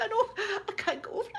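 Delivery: high, hurried mock distress; hammed-up fluttery panic; overwhelmed with shock. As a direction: gasp it out as the shaken woman. Shocked woman answers the neighbours.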